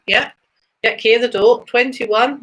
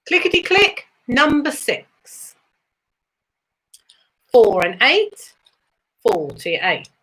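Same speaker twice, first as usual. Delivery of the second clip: sing-song bingo number calls, rising then falling; bingo caller; 2 semitones higher than usual. Acted. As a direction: call each number like a bingo hall host.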